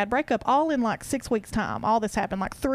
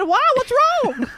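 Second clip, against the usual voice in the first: higher and louder mock alarm; mom's surprised reply; 12 semitones higher, 7 dB louder, slower than usual. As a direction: play mom's startled question. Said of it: "And she said".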